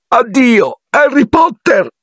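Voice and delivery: high, squeaky elf goodbye; Dobby, emotional farewell. A narrator's reading. Elf parts from Harry, emotional farewell. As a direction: bid farewell in a squeaky, moved voice.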